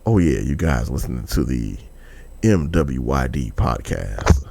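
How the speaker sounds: low, slow smooth radio host; late night host voice. Pretend. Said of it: "sounding like I'm a fucking late night host".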